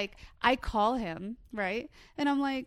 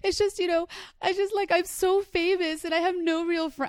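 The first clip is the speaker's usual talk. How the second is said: higher, louder mock-tragic diva voice; overly dramatic; 9 semitones higher, 6 dB louder than usual. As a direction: ham up the lonely star act. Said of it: "I was being so dramatic".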